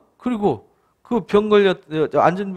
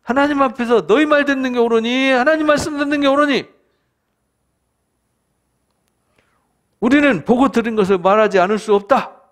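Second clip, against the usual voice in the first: higher, louder rebuke, full of conviction; bold shout; 6 semitones higher, 4 dB louder than usual. Retold or imitated. Peter defying the council, raised ringing shout. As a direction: shout it at the council like you can't hold back. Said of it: "하면서 큰 소리를 쳤어요".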